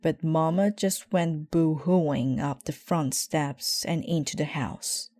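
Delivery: ordinary delivery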